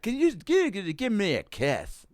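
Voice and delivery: put-on high, slow, slurred drunk plea; slurring drunk woman. Retelling the drunk heckler asking the comic for a kiss, high and slurred. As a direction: play the sloppy drunk heckler begging for a kiss. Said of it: "starting to slur".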